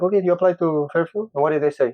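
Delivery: lightly voiced intern, brisk and friendly; intern asking. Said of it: "and then she's like".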